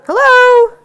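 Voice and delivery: very high, loud doorbell hello; loud distraction hello. Shown as a demonstration. Trainer testing the dog with a loud greeting, high and loud. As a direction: sing it out like greeting a guest at the door. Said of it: "until things calm down".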